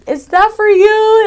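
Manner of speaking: high, loud startled question; mother alarmed at the police lights; urgent high-pitched worry; mom's alarmed question. Retold or imitated. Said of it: "and she's like".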